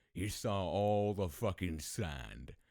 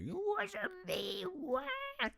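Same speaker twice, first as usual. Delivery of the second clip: very high, drawn-out fake wail; mock crying; 6 dB louder, much slower than usual. Wrongly accused brother protesting to dad, mock crying. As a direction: imitate a bawling boy, hammed up. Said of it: "straight up crying".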